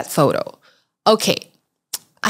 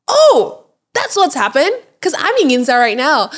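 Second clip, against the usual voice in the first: high, louder burst of "oh", easing down; surprised realization; 7 semitones higher, 4 dB louder than usual. Retold or imitated. Solo traveller replying to a friend's tip, surprised realization. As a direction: react with sudden discovery, pitched up.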